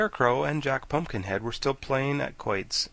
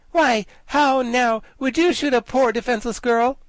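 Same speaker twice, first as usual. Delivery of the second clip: reproachful; 13 semitones higher, 9 dB louder than usual. Acted. Ginger reproaches the soldier, high coy reproach. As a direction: play the helpless girl. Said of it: "said reproachfully".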